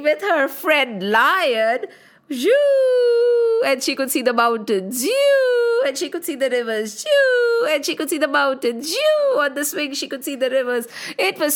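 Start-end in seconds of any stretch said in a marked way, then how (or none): 2.3-3.6 high, long gliding zoo sound; swing whoosh. Acted. 5.0-5.8 high, long gliding zoo sound; swing whoosh. Acted. 7.0-7.7 high, long gliding zoo sound; swing whoosh. Acted. 8.9-9.4 high, long gliding zoo sound; swing whoosh. Acted.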